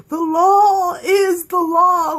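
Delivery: slow, high, loud mock-solemn Magnus drawl. Vlogger imitating Magnus mocking the law, slow and grand. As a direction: stretch it out like a grand decree. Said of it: "however he said it".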